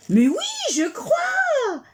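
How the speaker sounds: cri de joie bien plus aigu; s'exclamer, ravie; joyeuse. Acted. La souris trouve sa maison. (souris qui crie sa joie)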